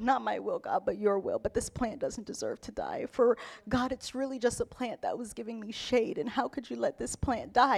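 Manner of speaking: hushed, hammed-up grieving prayer; quiet mock lament to God; mock mournful plea. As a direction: plead softly as if mourning, tongue in cheek. Preacher mimics Jonah mourning the plant.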